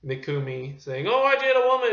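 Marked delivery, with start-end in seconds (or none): 1.1-1.9 high, loud imitation of an outcry; mock accusation. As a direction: mimic an indignant crowd. Mimics film characters' accusations.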